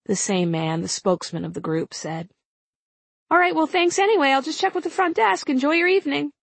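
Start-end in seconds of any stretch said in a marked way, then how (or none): 3.3-6.3 high, bright fake cheer; breezily upbeat, covering nerves; chipper. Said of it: "how chipper this came out".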